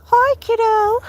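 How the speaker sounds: high, loud cartoon pet voice; Looney voice. Owner tries out a voice for the dog, high and loud. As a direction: pitch it way up and hold the last vowel. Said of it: "Looney's voice is just kinda like".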